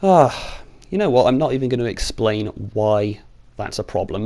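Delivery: usual speaking voice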